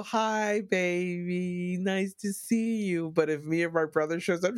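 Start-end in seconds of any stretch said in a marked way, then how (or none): 0.0-3.1 mom's sweet, higher, slow hello; sugary mom greeting. Retold or imitated. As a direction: coo it like a doting mother. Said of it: "she'll show up and she'll go".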